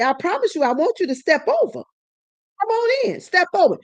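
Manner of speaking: high, sweetly mocking invitation; teasing a violent man to cross the door; taunting dare. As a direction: bait him over the threshold. Daring husband to step over.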